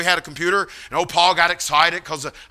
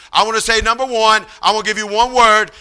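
higher, childlike imitation with w for l and r; pitched-up, level, heartfelt declarations; Paul preaching; 3 semitones higher, 3 dB louder than usual. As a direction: imitate an earnest, simple young man testifying. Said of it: "he says".

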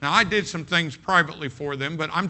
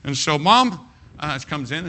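ordinary talk

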